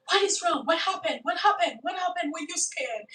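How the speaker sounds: high, urgent, rising panic; startled woman pressing a shaken man; alarmed questions. Retold or imitated. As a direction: pepper him with frightened questions. Lady panics at John's fright.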